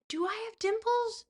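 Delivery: high-pitched incredulous surprise; astonished question. Real, not acted. Streamer reacts to chat's dimple compliment, astonished. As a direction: ask it in high, amazed disbelief.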